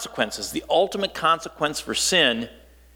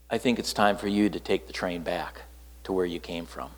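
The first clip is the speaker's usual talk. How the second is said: low, much softer stern warning; 7 semitones lower, 7 dB quieter than usual. Retold or imitated. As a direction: warn them firmly under your breath. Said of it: "she said it very sternly".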